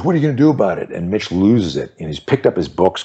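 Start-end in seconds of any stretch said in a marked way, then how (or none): 0.0-0.9 taunting bully. Retold or imitated. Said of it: "the guy goes".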